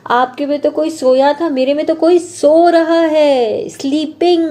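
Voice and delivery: put-on a baby bear telling his parents. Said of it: "वो बोलता है". (नन्हे भालू की ऊँची उत्साहित आवाज़)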